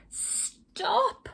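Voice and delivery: high, loud panicky cry, fast; high frantic cry; alarmed cry. Acted. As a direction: squeal at them to stop before it bursts. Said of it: "till the others cried".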